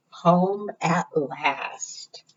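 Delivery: soft, slow whisper closing the story; whispered ending. A narrator's reading. Storyteller whispering the final line to children, slow and soft. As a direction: breathe the last line out softly.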